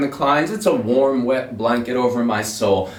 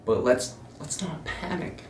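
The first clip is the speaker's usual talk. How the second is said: calm and cool; 8 dB quieter than usual. Retold or imitated. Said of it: "being cool as a cucumber".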